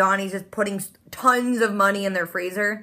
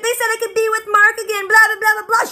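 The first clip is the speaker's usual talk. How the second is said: much higher, louder sing-song mockery; mocking Gracie; 11 semitones higher, 5 dB louder than usual. Vlogger mocking Gracie's excuse to Cosima, high loud sing-song. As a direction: parrot her excuse dismissively.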